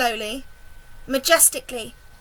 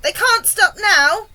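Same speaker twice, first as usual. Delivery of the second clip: slow, high, loud insistence; urgent shouted reply; 7 semitones higher, 8 dB louder, slower than usual. A narrator's reading. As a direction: shout it back, stretching the words. James answers the yelling, urgent.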